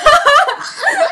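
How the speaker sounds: loud high burst of laughter at her own tease. Real, not acted. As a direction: crack up loudly. (大声で吹き出して笑う)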